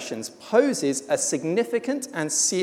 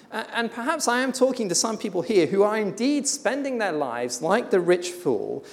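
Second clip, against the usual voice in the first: usual speaking voice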